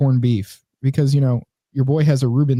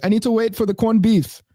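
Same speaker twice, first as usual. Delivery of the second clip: higher-pitched imitation of Caribbean speech; mock Caribbean accent; 8 semitones higher than usual. Deli worker explains the wait, mock Caribbean accent. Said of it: "do her accent a little better".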